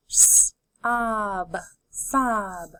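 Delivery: d, ot, dot, parts pulled apart then joined; D, ot, dot: each part separate, then the whole word; segmented then blended. Shown as a demonstration. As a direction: sound it out and blend it. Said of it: "Let's keep going".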